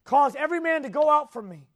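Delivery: loud, high-pitched command; crying out. A narrator's reading. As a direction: call it out forcefully while reading aloud. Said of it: "And he cried".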